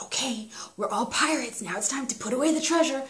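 put-on whispered pirate game for kids; hushed, conspiratorial make-believe; playful whisper. Shown as a demonstration. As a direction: whisper it like a secret mission, rising in pitch. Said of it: "I do think the whispering technique".

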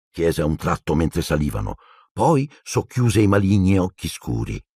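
ordinary delivery

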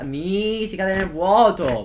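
high, loud, slow drawn-out wail; dismayed wail. Real, not acted. Gamer wailing as the character falls into the void, slow, high and loud. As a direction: stretch it out as you fall.

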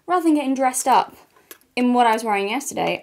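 ordinary talk, nothing marked

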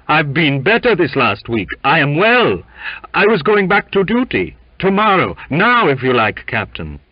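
loud, high, urgent protest; agitated, emphatic insistence; insistent. A narrator's reading. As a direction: plead fitness to the captain forcefully. Sick man insists he is well.

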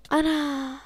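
normal talking voice

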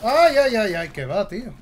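loud, high panicked cries. Real, not acted. Gamer panicking as enemies nearly see him, loud high cries. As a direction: yelp in panic as you're spotted.

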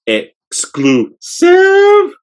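loud, slow announcer shout; drawn-out excited shout; hyped shout. Real, not acted. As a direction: call out the name like a hype man. Hyped shout of the shoe name.

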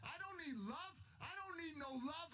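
high-pitched mock scream, held back in volume; retold scream. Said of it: "And I'm screaming".

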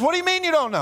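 high-pitched, incredulous demand; exasperated angel. Acted. Angel questioning the thief at heaven's gate, exasperated. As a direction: challenge him in comic disbelief.

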